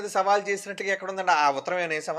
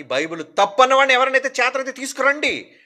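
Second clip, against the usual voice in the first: louder and higher, a full preaching call; rising challenge; 6 semitones higher, 6 dB louder than usual. Acted. Preacher daring critics of the Bible to come forward, loud and high. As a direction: throw the dare out to the whole congregation.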